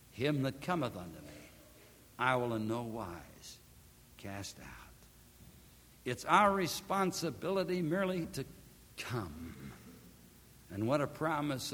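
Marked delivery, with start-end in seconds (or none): 0.1-4.6 preacher softly repeating the verse to the listeners; hushed repetition. Acted. Verse repeated softly after pause. 9.0-9.7 whispered single word, much lower. Acted.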